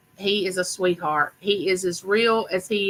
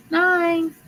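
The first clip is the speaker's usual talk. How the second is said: nine sung up high; high sing-song; 9 semitones higher than usual. Real, not acted. Host announcing the winning number, playful high call.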